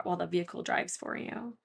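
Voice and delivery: ordinary talk, nothing marked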